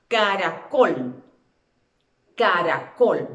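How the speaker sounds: "caracol" loud and drawn out, leaning on "col"; stress on last syllable. Shown as a demonstration. Teacher leading repetition to find the stress, "caracol" stressed on "col". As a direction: punch the final "col" of "caracol", slowly.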